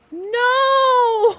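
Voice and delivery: very high, loud wail of loss; anguished cry. Real, not acted. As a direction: cry out in despair. Losing the baby fox.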